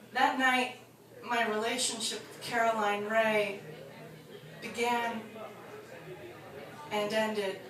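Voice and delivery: wrapping the story up gently; quiet reflective close. Acted. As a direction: settle into the sad moral.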